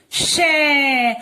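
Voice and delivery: शै held long, gliding down; drawn-out syllable. Shown as a demonstration. Teacher answering for the child, drawn-out शै. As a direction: stretch the vowel of शै. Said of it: "इसे क्या बोलेंगे?".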